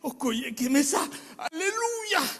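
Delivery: joyful shouting of praise in his own language; ecstatic praise. Real, not acted. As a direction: shout thanks to God in joy. Ecstatic praise after recovery.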